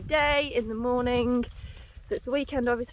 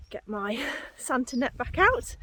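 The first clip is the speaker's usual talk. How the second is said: usual speaking voice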